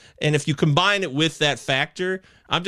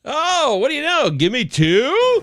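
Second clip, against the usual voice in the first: 1 mock outrage; 11 semitones higher, 6 dB louder, slower than usual. Real, not acted. Mock indignant at a setup pick.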